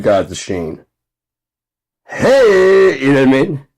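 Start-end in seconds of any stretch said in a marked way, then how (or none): 2.1-3.7 high, loud crooned greeting; lounge singer. Retold or imitated. Showing the sheen, high loud lounge singer hey.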